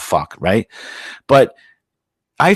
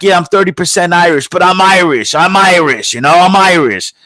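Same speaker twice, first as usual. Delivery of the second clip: higher, mocking boast of being Irish; mock Irish pride; 7 semitones higher, 4 dB louder than usual. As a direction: puff up like a proud part-Irish American. Mocking proud part-Irish Americans.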